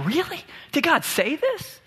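high, quick incredulous gasp; mock surprised. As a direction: play the shocked gossip. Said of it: "Satan is surprised".

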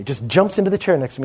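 ordinary talk

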